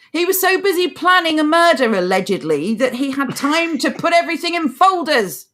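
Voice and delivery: high-pitched mocking incredulity; sarcastic, dropping briefly for the aside; overdone sarcasm. Real, not acted. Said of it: "did I overdo the sarcasm there".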